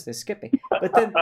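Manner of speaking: normal talking voice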